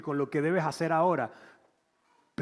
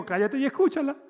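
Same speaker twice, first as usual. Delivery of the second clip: voice of the Spirit; 9 semitones higher, 3 dB louder than usual. Retold or imitated. Voicing the Holy Spirit.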